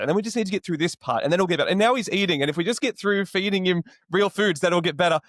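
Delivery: climbing, wound-up repeat of the same promise; mounting exasperation. Retold or imitated. New father, hoping each stage gets easier, rising exasperation. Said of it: "my wife and I have turned to each other and gone".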